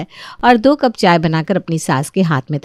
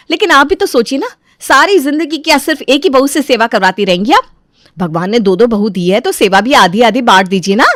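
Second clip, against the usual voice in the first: lively voiced daughter-in-law objecting politely; protesting daughter-in-law; 6 semitones higher, 5 dB louder than usual. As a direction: plead your case to mother-in-law.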